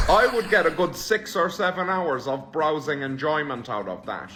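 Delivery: usual speaking voice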